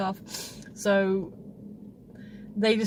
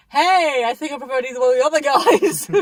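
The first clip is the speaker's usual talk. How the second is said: loud laughing retort; 4 semitones higher, 7 dB louder than usual. Retold or imitated. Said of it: "I was like".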